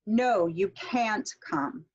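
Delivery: slow, weight on "No" and "can't"; stressed negatives. Shown as a demonstration. Teacher showing that negatives carry stress, "No" and "can't" stressed. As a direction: stretch "No", hit "can't". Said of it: "any negatives tend to be stressed".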